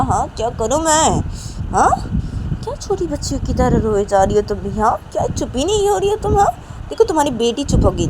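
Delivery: loud, rising reproach; exasperated scolding. A narrator's reading. Manisha to crying Nisha, exasperated. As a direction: tell off a grown-up who cries like a child.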